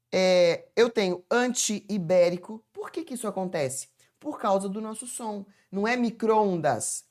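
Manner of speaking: usual speaking voice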